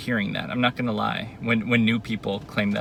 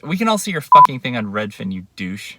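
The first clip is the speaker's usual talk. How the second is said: angry; 8 dB louder than usual. Real, not acted. Ranting at absent boyfriend.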